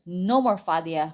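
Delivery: ordinary delivery, nothing marked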